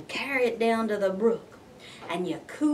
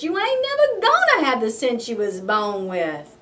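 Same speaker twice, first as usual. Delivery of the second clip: loud exasperated scolding, repeating the refrain; mama scolding; 4 semitones higher, 8 dB louder than usual. Acted. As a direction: scold him like a fed-up mama. Mama scolding her son.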